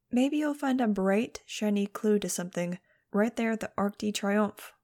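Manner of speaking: plain reading voice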